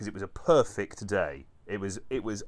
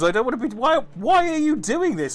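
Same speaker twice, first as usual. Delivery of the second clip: high, loud, pleading whine; exasperated pilot whine; 13 semitones higher, 11 dB louder than usual. Retold or imitated. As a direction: plead at the plane in rising despair. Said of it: "I'm just there going".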